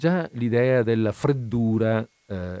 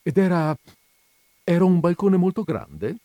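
high, louder curious question; keenly interested; 9 semitones higher, 5 dB louder than usual. A narrator's reading. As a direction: probe with eager interest. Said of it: "si interessò". (vivo interesse, tono alto)

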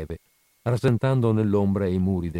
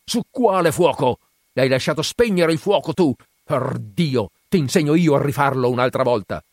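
higher, louder furious outburst; 5 semitones higher, 5 dB louder than usual. A narrator's reading. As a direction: rage at the boy over the fire. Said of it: "balzò in piedi furente". (sfuriata del padre)

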